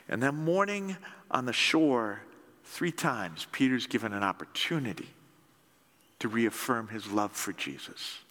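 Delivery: usual speaking voice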